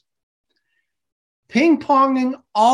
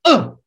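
loud demo groan, higher; 6 semitones higher, 6 dB louder than usual. Shown as a demonstration. Teacher demos the class turtle groan, loud. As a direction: let out a big class groan. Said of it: "groan like this".

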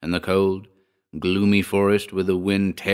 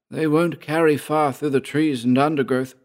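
higher-pitched character voice; doubtful character in a raised pitch; Georg; 7 semitones higher than usual. A narrator's reading. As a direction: pitch it up for the second man. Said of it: "said Georg".

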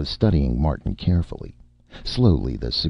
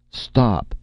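higher-pitched cry, only mildly bellowed; bull's bellow; 8 semitones higher than usual. A narrator's reading. Director halting the contract handover, mild bellow. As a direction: cry a halt like a restrained bull. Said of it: "cried Saint Cyr in a bull's bellow".

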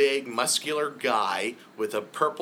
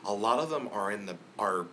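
ordinary talk

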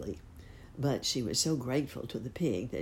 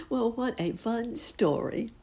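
ordinary delivery, nothing marked

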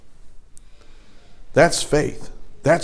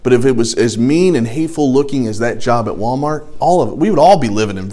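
ordinary talk

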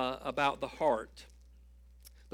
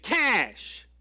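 barked loud and higher; angry snap; 6 semitones higher, 6 dB louder than usual. Retold or imitated. Frustrated man snapping at the sweet clerk, loud. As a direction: lash out with one sharp word. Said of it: "he lashed back out".

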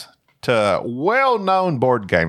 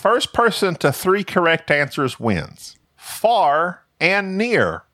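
high, drawn-out quizmaster reading; host slowly unveiling the first clue; quiz show host; 7 semitones higher, slower than usual. Pretend.

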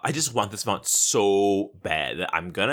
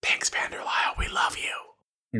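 whispered thanks; 4 dB quieter than usual. Pretend. Co-host thanking a fan who wrote in, whispered. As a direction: whisper it, drawing out the last words.

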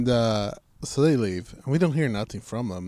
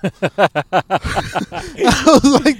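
laughing; 9 semitones higher, 9 dB louder, much slower than usual. Real, not acted. Laughing at dad's text.

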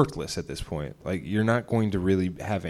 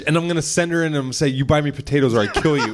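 higher, fast hammed-up tough-guy threat; mock threat; 6 semitones higher, 4 dB louder, faster than usual. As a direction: play a cartoon villain demanding potatoes. Joking mock gangster threat.

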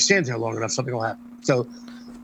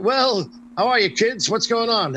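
booming greeting; 8 semitones higher, slower than usual. Retold or imitated. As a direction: boom it out across the sidewalk to greet the kids. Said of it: "And I boom out".

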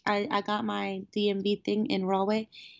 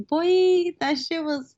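loud, high exclaim; loud exclamation; 8 semitones higher, 7 dB louder, slower than usual. Real, not acted. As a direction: burst out with it. Exclaiming about the distance.

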